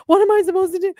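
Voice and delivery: high, loud frantic question; exasperated panic. Retold or imitated. Speaker overwhelmed by the ghost's plea, high and frantic. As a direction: throw it up in disbelief.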